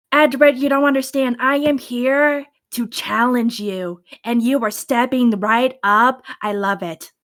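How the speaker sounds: ordinary delivery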